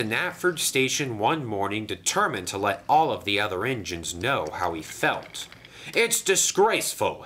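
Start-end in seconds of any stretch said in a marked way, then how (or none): 5.9-7.2 higher, louder indignant splutter; spluttering indignation. A narrator's reading. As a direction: splutter in outrage. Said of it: "he spluttered".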